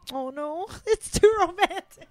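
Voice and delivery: high, laughing mock alarm; mock dismay. Watching the film's romance with a co-host, laughing mock dismay.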